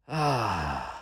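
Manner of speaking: long, low, soft "ah"; convincing contented sigh. A narrator's reading. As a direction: let out a drawn-out, convincing sigh. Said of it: "let out a convincing".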